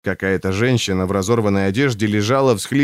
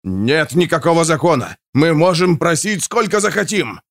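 mild yell, pitch climbing as it goes; yelling back; 6 semitones higher than usual. A narrator's reading. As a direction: stallholder hollering back, understated. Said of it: "заорал в ответ хозяин прилавка".

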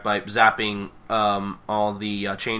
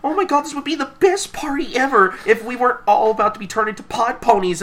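very high-pitched gushing; hammed-up excitement, pitched way up; Pinkie Pie excited; 11 semitones higher, 3 dB louder than usual. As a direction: imitate a thrilled, squealing party lover. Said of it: "only one fucking excited about this".

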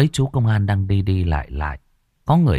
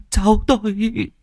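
much higher, slow, tearful wail; sobbing grandmother; 13 semitones higher, slower than usual. A narrator's reading. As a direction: sob it out with relief. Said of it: "Bà cứ thế khóc nức nở".

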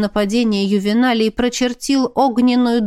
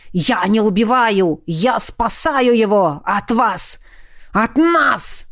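furious dragon. A narrator's reading.

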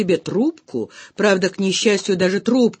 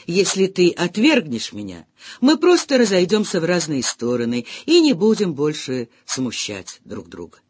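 ordinary delivery

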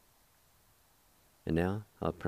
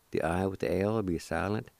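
ordinary delivery

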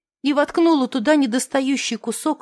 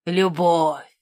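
низкое торжественное «Любовь!» с падением; протяни «Любовь» низко и напыщенно; пафосный возглас; 7 semitones lower than usual. Acted. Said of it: "Он взялся за пафосные речи". (пафосно, низким голосом)

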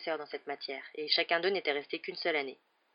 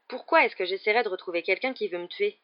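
ordinary delivery, nothing marked

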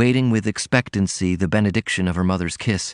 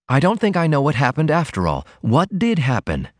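put-on higher girlish voice, confused; puzzled Ruth; 7 semitones higher than usual. A narrator's reading. Daughter puzzled by her mother, higher voice. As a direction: ask in puzzled innocence. Ruth's voice held.

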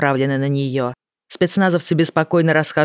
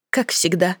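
forced joke; 4 semitones higher than usual. Acted. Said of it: "пошутила она с вымученной улыбкой".

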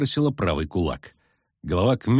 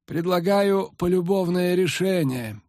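slow, soft reassuring voice; soft and gentle; 9 semitones higher, slower than usual. A narrator's reading. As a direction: gently offer a compromise. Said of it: "мягко проговорил Регис".

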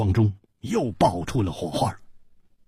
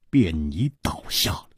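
plain reading voice

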